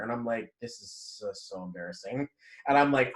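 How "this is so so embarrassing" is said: much softer, lower, mumbled under the breath; a hint of retold embarrassment, muttered to himself; embarrassed mutter. Embarrassed aside during fight with mom.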